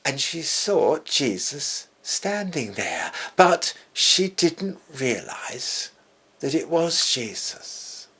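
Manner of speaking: normal talking voice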